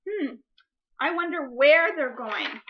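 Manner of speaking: ordinary delivery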